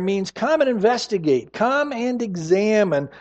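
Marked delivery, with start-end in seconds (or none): none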